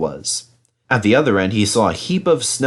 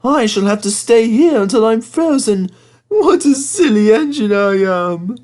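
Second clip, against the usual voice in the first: sad engine; 12 semitones higher, 5 dB louder than usual. Acted. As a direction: moan over his wheels like a sorry little engine. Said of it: "said Thomas sadly".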